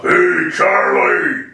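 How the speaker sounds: slow, rough drawn-out holler; rough mock counter-girl yell; rougher woman's voice. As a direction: call out roughly, stretching each word. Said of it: "Actually, it was more rough".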